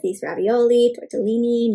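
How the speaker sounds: usual speaking voice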